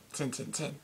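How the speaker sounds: a quick, clipped Lincolnshire "Tin, tin, tin"; Lincolnshire dialect. Retold or imitated. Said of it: "It basically is".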